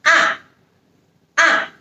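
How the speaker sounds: ah falling sharply from high; fourth tone ah. Shown as a demonstration. Teacher modelling the falling fourth tone. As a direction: snap ah down from the top. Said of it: "pronunciation is also feeling like a downwards".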